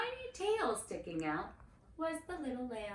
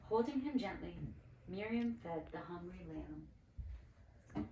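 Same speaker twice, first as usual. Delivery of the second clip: ordinary delivery, nothing marked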